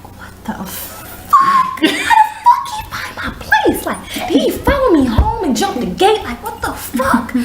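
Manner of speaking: high, slow, disbelieving; stunned he found the house; shocked disbelief. Retold or imitated. Woman realizing a man followed her home, high shocked disbelief.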